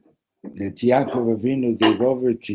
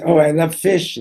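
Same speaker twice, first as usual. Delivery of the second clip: loud, higher-pitched burst of relish; raised, enthusiastic storybook-king delivery; delighted king; 7 semitones higher, 8 dB louder than usual. Acted. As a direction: exclaim it as a king thrilled by his dinner. King in anecdote delights in fish.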